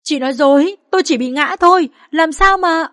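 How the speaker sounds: high, loud disbelieving wail; anguished cry. Acted. Woman told of her miscarriage, wailing in denial. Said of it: "gào lên".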